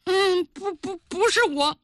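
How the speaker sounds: put-on high, halting little-boy denial; scared stammering child. A narrator's reading. Boy denying guilt to the angry queen, high stammer. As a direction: stumble over the first word as a nervous boy.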